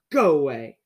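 loud, grumpy dismissal; snapped. A narrator's reading. Mister Grumpy orders Mister Happy away, snapped. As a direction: send him off with a loud snap. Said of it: "snapped Mister Grumpy".

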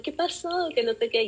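high, soft concerned asking; lightly imitating a worried sister. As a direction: voice the anxious sister gently. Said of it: "mi hermana".

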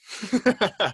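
high giggle. Real, not acted. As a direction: burst into a giggle. Joking about sex.

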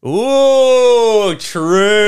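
high, loud drawn-out hooting; emphatic agreement. Real, not acted. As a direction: whoop in delighted agreement. Loud agreement with co-host's joke.

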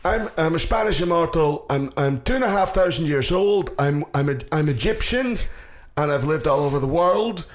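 high, hammed-up Scottish brogue; Connery's Scottish accent. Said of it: "sounds more Scottish than anybody else".

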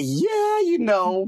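high, squeaky mocking echo; mocking her impression. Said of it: "I didn't say".

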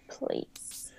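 a soft unvoiced please; whispered please. Real, not acted. Timid guess.

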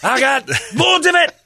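very high, loud mock Scottish; Scottish accent. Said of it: "if you say it with a Scottish accent".

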